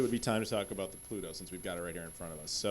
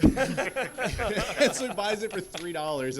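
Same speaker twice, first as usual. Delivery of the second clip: laughing through it; 8 semitones higher, 9 dB louder, much slower than usual. Real, not acted. Laughs at the three-dollar deal.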